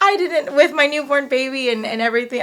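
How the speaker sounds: laughing it out, pitch jumping up; real laugh breaking through the words; laughing at the memory of the chaos. Real, not acted.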